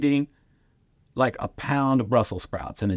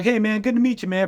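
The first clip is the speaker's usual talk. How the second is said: high, bright mock Freeze greeting, much higher; imitating Freeze; 14 semitones higher, 4 dB louder than usual. Comedian imitates opener Freeze greeting him, high mock voice. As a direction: play the friendly opener, pitched way up. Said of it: "he's like".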